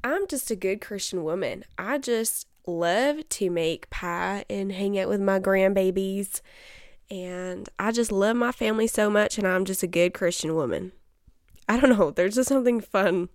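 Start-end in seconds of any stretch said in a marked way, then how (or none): 0.0-10.9 mock southern accent. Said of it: "fun to talk in a southern accent and be like".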